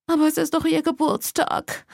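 high-pitched, plaintive whimper. A narrator's reading. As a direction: whimper it like a distraught mom. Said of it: "Mom wimmerte".

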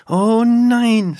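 loud, high, drawn-out dismay; exasperated boy, voice pushed up. A narrator's reading. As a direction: wail it in despair at her naivety. Boy groans, slaps his forehead.

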